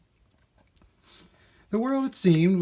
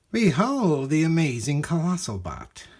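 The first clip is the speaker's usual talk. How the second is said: grand showman announcing, a touch higher; proud shopkeeper; 5 semitones higher, 4 dB louder than usual. Acted. Shopkeeper showing off robots to a boy, grand. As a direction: present it like a salesman.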